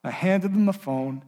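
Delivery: normal talking voice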